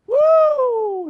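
a high, loud whoop of joy; joyful whoop. Retold or imitated. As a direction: let out a happy whoop like the son. Son's joyful cry.

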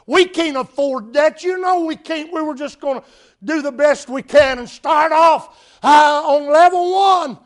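loud, high-pitched upset protest; upset bride. Retold or imitated. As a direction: act out a bride's indignant outburst. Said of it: "And she got upset".

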